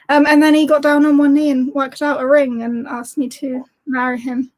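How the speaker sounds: usual speaking voice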